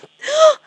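sharp, high gasp; loud startled intake of breath; sudden gasp. Real, not acted. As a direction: gasp loudly, caught off guard. Sudden reaction while handling the device.

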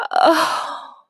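high, breath-heavy moan. A narrator's reading. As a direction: let out a long, high "oh". Character reacting to the sensation.

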